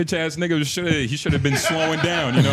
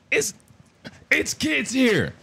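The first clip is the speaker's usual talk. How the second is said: high-pitched imitation of a scolding driver; mock yelling; 7 semitones higher than usual. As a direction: mimic his protest high, without real volume. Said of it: "you fucking yelling at me".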